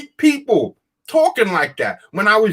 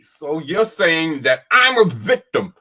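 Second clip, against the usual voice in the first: ordinary delivery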